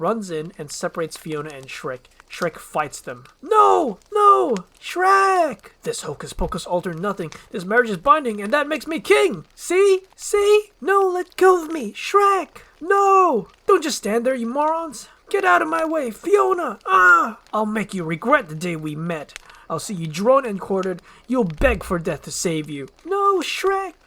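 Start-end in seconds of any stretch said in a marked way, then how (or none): 3.4-5.7 very high, loud scream; high, loud panicked shout; panicked Fiona. Acted. 5.8-22.9 loud, high furious tirade; high, loud villain rant; ranting Farquaad. Acted. 23.1-23.9 very high, loud cry; high panicked shout; desperate Fiona. Acted. Fiona screams.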